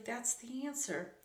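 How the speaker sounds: plain reading voice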